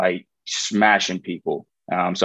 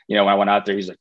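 normal talking voice

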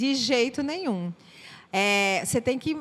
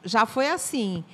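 normal talking voice

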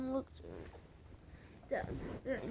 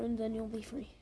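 ordinary delivery, nothing marked

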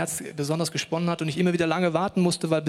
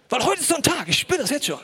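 deutlich höher, verstellte Stimme für „heute ist so ein Tag“; „heute“ hoch und ironisch betont, wie ein übertriebener Hellseher; gespielt ahnungsvoller Josef; 9 semitones higher than usual. Pretend. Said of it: "hat sich überlegt". (Josef ahnt spöttisch gespielt den Brunnen voraus)

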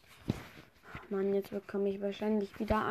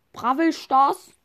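puzzled grandpa; 5 semitones higher, 7 dB louder, slower than usual. Pretend. Grandpa mishears name.

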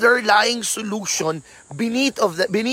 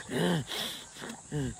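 a much softer, swallowed hum; holding back hurt; 8 semitones lower, 17 dB quieter than usual. Retold or imitated. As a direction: hum it low, hiding the pain. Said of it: "pag nasaktan".

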